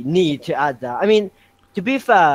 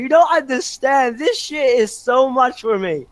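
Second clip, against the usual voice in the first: retold scream; 8 semitones higher than usual. Fan retelling being kicked out crying, high mock scream. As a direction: wail it high, half-screamed. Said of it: "I started screaming and I was like".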